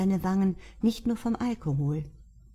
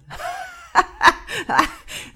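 high, loud helpless laughter; belly laugh; 14 semitones higher, 9 dB louder than usual. Acted. Luke laughs at Mark's claim, high and loud. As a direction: laugh till it hurts. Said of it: "hielt sich den Bauch vor Lachen".

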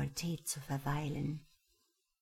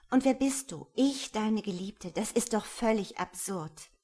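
sharp, higher, louder protest; indignant outburst; 5 semitones higher, 5 dB louder than usual. Acted. Patricia rejecting the stranger's claim, indignant and louder. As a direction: throw his claim back at him in disbelief.

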